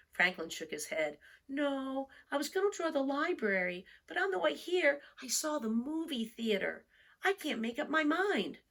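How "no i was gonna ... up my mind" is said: put-on higher, fretful child voice; an undecided young turtle explaining; Franklin's child voice. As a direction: let it rise, a bit worried.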